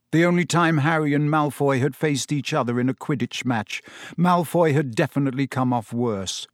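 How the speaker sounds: ordinary delivery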